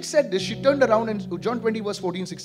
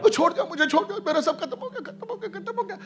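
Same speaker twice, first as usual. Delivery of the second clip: high, rushed mock wailing; mock lament; 8 semitones higher, much faster than usual. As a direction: whine it like a self-pitying sob story. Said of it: "बंद कर तेरा violin".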